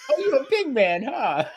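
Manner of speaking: high jeer through laughter; laughing mock taunt. Retelling the woman's taunt while laughing, high and mocking. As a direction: taunt him while cracking up. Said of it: "And she's like".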